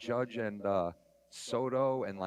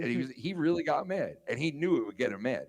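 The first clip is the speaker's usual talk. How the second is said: ordinary talk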